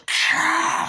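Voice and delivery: very high, loud breathy monster hiss; creature hiss. Retold or imitated. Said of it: "the fucking just thing goes".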